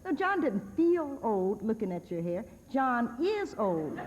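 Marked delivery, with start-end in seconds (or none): 2.7-4.0 drawn-out, deliberate punchline; slow emphatic. Real, not acted. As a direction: land it slowly.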